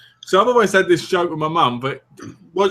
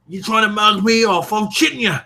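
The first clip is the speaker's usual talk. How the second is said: East End accent; 5 semitones higher than usual. Shown as a demonstration. Teaching partner the lingo, proper East End accent. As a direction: challenge them in broad East End. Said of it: "getting a proper Eastern accent".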